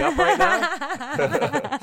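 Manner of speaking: high, slow laughing question. Real, not acted. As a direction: giggle through the question. Laughing at the awkward setup.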